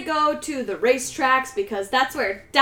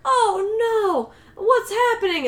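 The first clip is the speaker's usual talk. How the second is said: high, plaintive mock wail; distressed older woman; 8 semitones higher, slower than usual. Older woman climbing out after the crash, distressed. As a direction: play a dismayed older driver, pitched up.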